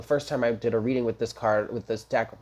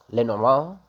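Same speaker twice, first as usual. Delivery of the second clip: louder put-on French accent; 4 dB louder than usual. Shown as a demonstration. Tarot reader trying out the card deck's name, French accent. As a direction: say Lenormand with a French lilt, rising. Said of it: "Like, with a French accent?".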